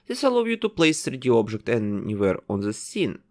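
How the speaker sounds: ordinary talk, nothing marked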